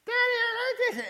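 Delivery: put-on child presenting a drawing to daddy; little child voice. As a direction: squeak it like a small kid showing a picture. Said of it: "your grandkids or your kids come up to you".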